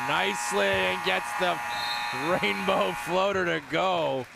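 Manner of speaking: rising, building play-by-play excitement; excited call. Real, not acted. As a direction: lift it as the shot goes up.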